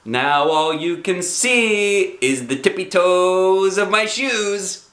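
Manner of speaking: high, slow, delighted; gleeful kid voice; very happy. Acted. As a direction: beam with pride. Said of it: "I said, very happy".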